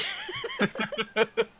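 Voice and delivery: loud high burst of laughter; big laugh. Real, not acted. Game master cracking up at the table, laughing loudly. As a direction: crack up at your own joke.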